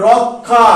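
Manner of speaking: রক্ষা slowly as rok-kha; example pronunciation. Shown as a demonstration. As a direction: stretch রক্ষা, holding the k before kha. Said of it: "আরেকটা উদাহরণ লিখেছি".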